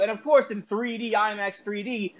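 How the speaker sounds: ordinary talk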